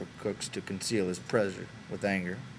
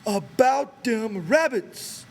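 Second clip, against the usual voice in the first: put-on high, very slow childlike insistence; insistent Lennie; 14 semitones higher, 7 dB louder, much slower than usual. A narrator's reading. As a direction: drag each word out, stubborn as a child. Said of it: "he insisted".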